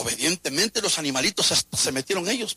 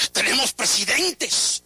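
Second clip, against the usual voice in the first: preaching at full force; 8 semitones higher than usual. Acted. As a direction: thunder the warning to the church. (predicación fervorosa a todo pulmón)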